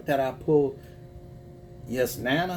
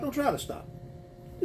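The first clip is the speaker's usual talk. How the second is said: softer, slower, hushed and voiceless; soft reassurance; 12 semitones higher, 7 dB quieter than usual. Real, not acted. To a heartbroken viewer, a soft slow hushed reassurance. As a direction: ease her worry gently, almost breathing it.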